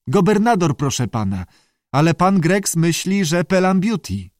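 high creature voice giving an opinion; squawky salamander. A narrator's reading. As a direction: answer in a high, polite squawk. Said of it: "zaskrzeczała". (wysoki, skrzekliwy głos salamandry)

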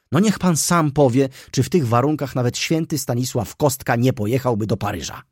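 high, loud, breathless gossip; excited young clerk. Acted. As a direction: rattle it off, scandalised and thrilled. Mraczewski's excited gossiping speech. (podniecony, głośny młody subiekt)

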